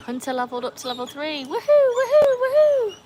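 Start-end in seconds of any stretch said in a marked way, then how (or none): 1.4-3.0 much higher, loud woohoos; cheering. Real, not acted. Celebrating level up.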